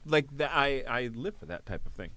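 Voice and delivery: ordinary talk, nothing marked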